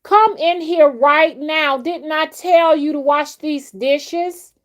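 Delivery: high, loud, slow scolding mother; mother yelling about the dishes; squeaky scolding mom. Retold or imitated. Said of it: "I sound exactly like her".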